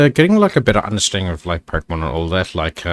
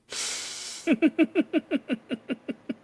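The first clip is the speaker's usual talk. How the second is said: high squealing laugh; 8 dB quieter than usual. Real, not acted. Laughs at own story.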